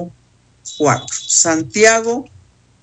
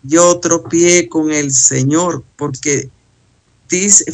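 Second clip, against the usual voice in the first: ordinary talk, nothing marked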